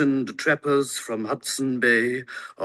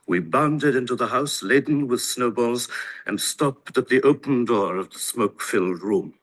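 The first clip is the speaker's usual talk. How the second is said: ordinary delivery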